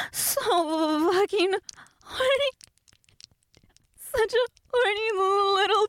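whimpering, desperate begging; stupid and needy. Acted. Performing the requested role for listeners, high needy pleading. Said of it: "stupid and needy".

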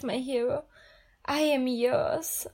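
ordinary delivery

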